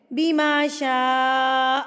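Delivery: slow recitation, شاء held very long; long madd. Shown as a demonstration. Teaching big madd, شاء drawn far out. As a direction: stretch "shaa" in شاء for four beats. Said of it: "شا کو کھینچ کے پڑھنا ہے".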